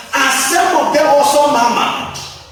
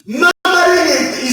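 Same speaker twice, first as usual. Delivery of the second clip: preaching shout; 7 semitones higher than usual. Acted. Preacher building to a climax.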